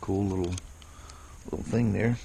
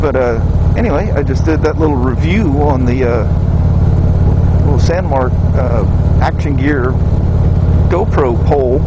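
talking loud over road and wind noise on a moving motorcycle; raised voice over wind; 2 semitones higher, 12 dB louder than usual. Real, not acted. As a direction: project the voice over the engine and wind. Talking while riding motorcycle in wind.

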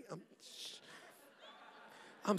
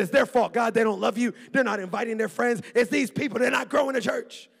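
loud, higher blaming rant; hammed-up indignant blame; mock angry complaint; 5 semitones higher, 13 dB louder than usual. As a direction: whine at God that it's their fault. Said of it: "I said".

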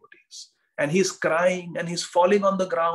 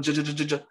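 quick repeated j sounds, teeth rattling; chattering teeth; faster than usual. Retold or imitated. Teacher imitates Mahaprabhu saying Jagannath, teeth chattering. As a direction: stutter it fast as if teeth chatter. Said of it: "chattering in Jagan".

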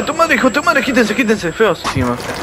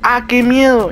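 hammed-up "¡Ah, qué miedo!" with a high, falling "miedo"; playful fake scare; mock scared; 6 semitones higher, slower than usual. Shown as a demonstration. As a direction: announce fake fright, pitched up. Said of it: "voy a decir".

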